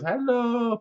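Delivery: high, singsong hello; high greeting. Retold or imitated. Rosa greets her in the film, high-pitched. Voices Rosa's greeting in retelling.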